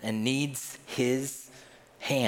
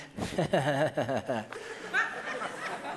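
toothy fake laugh; 2 semitones higher, 5 dB quieter than usual. Preacher imitates grinning emoji, fake toothy laugh. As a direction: run of hissy mock giggles. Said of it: "smiley face with the teeth".

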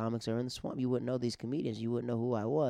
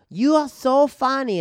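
high, loud and slow mock-aggressive compliment; aggressive praise; 12 semitones higher, 11 dB louder, slower than usual. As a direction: bark the compliment at him. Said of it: "in an, an aggressive way".

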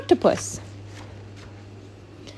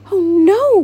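high, louder alarmed cry; gasped dismay; 6 semitones higher, 5 dB louder than usual. Acted. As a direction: cry out in shock as the pearl falls. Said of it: "gasped Octopus".

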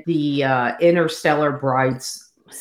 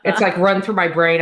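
normal talking voice

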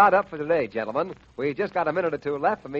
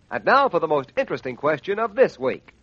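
normal talking voice

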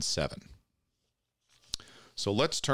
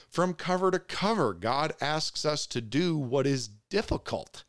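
high, impassioned pulpit voice; fervent preaching; 5 semitones higher than usual. Acted. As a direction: preach it with rising fire. Preaching builds to a peak.

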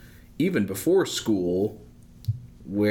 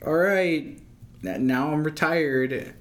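high, slow relieved retiree; 7 semitones higher, slower than usual. Retold or imitated. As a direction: sigh it out like a new retiree. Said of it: "they're like".

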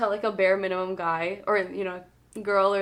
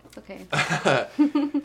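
laughing comfort; 5 dB louder, much slower than usual. Real, not acted. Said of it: "Let it out".

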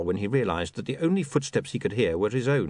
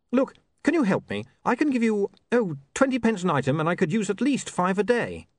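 put-on higher, hurried young man; apologetic and caught out; flustered young man; 10 semitones higher than usual. William pleads.

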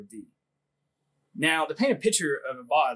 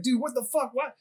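high-pitched retold outburst; flipping out; 9 semitones higher than usual. Airman confronting his sergeant in a retold freak-out, flipping out. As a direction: imitate someone losing it, pitch jumped up. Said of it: "he just flips out".

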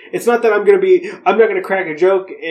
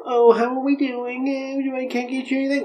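higher, airy put-on sweetness, mocking a fake-cheerful bedside manner; mock sweet nurse voice; 8 semitones higher, 5 dB quieter than usual. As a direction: chirp it up high like a fake-friendly nurse. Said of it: "Do you want me to go and be like".

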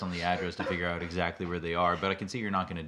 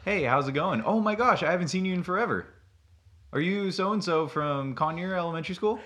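higher, quick excited greeting; role play dude; 9 semitones higher than usual. Pretend. Role play at the bar, playing the dude, mock eager. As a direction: gush like you just spotted an old classmate. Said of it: "You be the girl, I'll be the dude".

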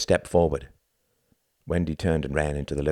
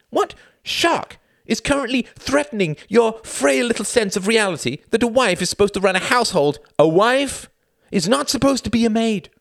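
angry shouting; 5 dB louder than usual. Acted. Escalating quarrel.

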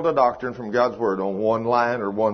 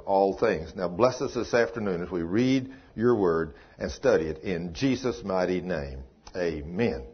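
prayer; 4 semitones lower than usual. Acted. Pastor opening the sermon with prayer, devout and hushed. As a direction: pray it humbly before the congregation.

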